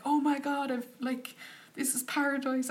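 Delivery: overjoyed at the dream room; thrilled gasp. Retold or imitated. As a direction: traveller seeing her cabin room, high thrilled exclaim. Seeing luxury room, quoted.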